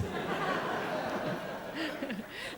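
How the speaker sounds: breathy, quiet laugh at her own line; soft laugh. Real, not acted. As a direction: let a soft laugh slip out. Laughs after her punchline.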